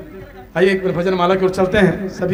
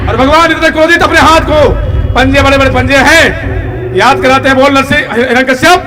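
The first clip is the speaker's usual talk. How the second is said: high, loud storytelling sermon; full-force preaching; 9 semitones higher, 13 dB louder than usual. Acted. Preacher telling the crowd of the enraged Narasimha, full-force preaching.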